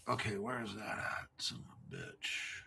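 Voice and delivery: very soft, low self-talk; muttering to self. Real, not acted. Streamer searching for lost article, low muttering to himself. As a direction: grumble under your breath while hunting for something.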